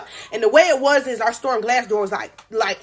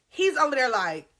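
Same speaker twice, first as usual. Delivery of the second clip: normal talking voice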